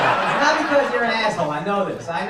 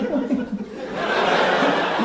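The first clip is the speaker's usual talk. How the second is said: mock dainty, pearl-clutching laugh; imitated coy laugh; 3 semitones higher, 4 dB quieter than usual. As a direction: imitate a man's flustered giggle. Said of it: "The way he was laughing".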